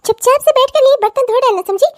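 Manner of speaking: put-on stern stepmother shouting at the girl; angry order. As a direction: snap the order at her. Stepmother scolding stepdaughter.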